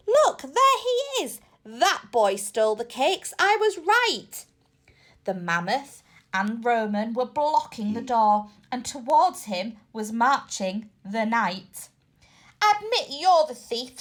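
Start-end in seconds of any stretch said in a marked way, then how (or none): 0.1-4.5 a big creature shouting in triumph; mammoth bellow. A narrator's reading. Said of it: "a voice bellowed". 12.6-14.0 lightly voiced knight growl. A narrator's reading. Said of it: "said the knight with a growl".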